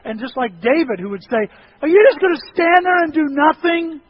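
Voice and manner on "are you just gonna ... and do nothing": high, loud indignant cry to God; re-enacted exasperated yell; worked-up demand. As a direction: challenge God at full voice. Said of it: "I'm going".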